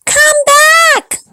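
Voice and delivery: high, loud call after him; shrill cry. Acted. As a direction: call out in alarm. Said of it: "cried his wife".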